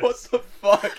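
high, loud disbelieving cry; laughing shock; shocked outburst. Real, not acted. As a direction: blurt out in mock horror. Shocked at friend's remark.

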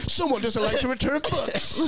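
lightly voiced high, slow taunt, laughing; a hint of a smug bounty hunter; mock bounty hunter. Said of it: "bounty hunter bust".

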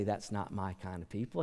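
quiet denial. Retold or imitated. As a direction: say it quietly, no scorn. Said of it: "God didn't say".

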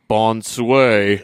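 a clumsy American trying French; stiff, proud bonsoir; French greeting from a tough guy; mock French. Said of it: "And so then he goes".

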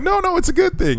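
high, sweet polite voice; reassuring teachers. Retold or imitated. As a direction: soothe him like gracious Japanese colleagues. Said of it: "And they're like".

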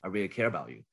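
soft, low reassurance; sympathetic caring. Shown as a demonstration. Coach repeating the caring persona demo, sympathetic and soft. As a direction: drop the voice and show tender concern. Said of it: "the sympathetic caring one".

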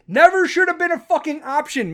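full-throated furious outburst; angry shout. Real, not acted. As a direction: yell it at Milley in outrage. Furious repeat aimed at Milley.

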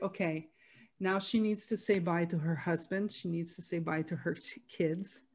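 softly voiced self-talk, hushed and steady; calm inner instruction. At mother's deathbed, telling herself what comes next, hushed and calm. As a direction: murmur it calmly to yourself as things unfold.

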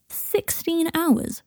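high, drawn-out horrified disbelief. A narrator's reading. Harry hearing how long Malfoy gets trapped, horrified. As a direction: stretch it out, pitched high in horror. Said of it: "repeated Harry in horror".

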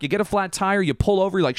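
ordinary delivery

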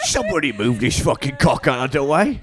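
hammed-up griping through laughter, climbing high; exasperated digger. Comic co-host voicing the digger tripping over the stone, hammed-up exasperation. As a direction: complain in a silly put-on voice, half laughing.

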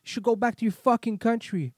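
high, loud acted tirade; insulting angry driver. Driver insulting him after apology, acted angry shouting. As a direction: hurl insults as the furious driver. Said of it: "kept insulting me".

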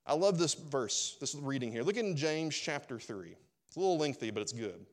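ordinary talk

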